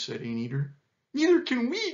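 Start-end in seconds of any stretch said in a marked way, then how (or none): 1.1-1.9 very high peccary wail; distressed cry. A narrator's reading. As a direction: cry it out in panic. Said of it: "cried the peccaries".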